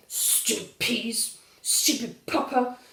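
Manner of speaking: put-on slow, grumbling sulky child; growling. Valdemar sulking about Papa and the peas, slow growl. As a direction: growl each stupid like a cross toddler. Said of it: "growls Valdemar".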